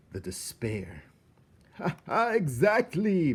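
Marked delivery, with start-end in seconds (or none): 1.7-3.3 high, loud gleeful laugh; laughing rat. Acted. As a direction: cackle it out with relish. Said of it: "Botticelli laughed".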